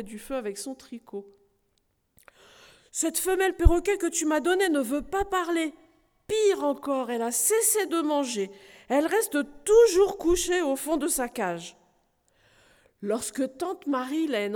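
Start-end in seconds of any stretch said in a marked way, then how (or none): 2.9-11.8 higher, louder grumbling knight; indignant complaint. Acted. Knight complaining to his aunt about the silent parrot, loud and indignant. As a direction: complain bitterly, pitched up and loud.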